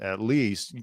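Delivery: ordinary delivery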